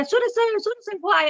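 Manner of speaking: high, eager calling out; excited calling. Retold or imitated. Fan spots the organiser and calls him, high and eager. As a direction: hail him across the lobby, high. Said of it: "मैंने चिल्लाना शुरू किया".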